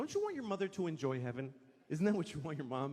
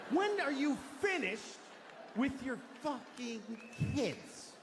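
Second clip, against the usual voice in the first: mock rant; 8 semitones higher, slower than usual. Comic scolds the football player over his dead mom, rising rant. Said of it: "I wanted to be there to say".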